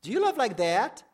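high and loud, searching; pointed question. Shown as a demonstration. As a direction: put it straight to one listener. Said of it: "I'm looking like this intently".

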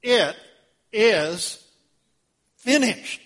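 triumphant. Acted. Jesus cries out in victory from the cross, loud and triumphant. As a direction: shout it out as a victory.

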